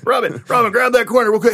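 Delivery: high, loud call across the room. Acted. Batman shouting for Robin to grab the table. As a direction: shout for the sidekick.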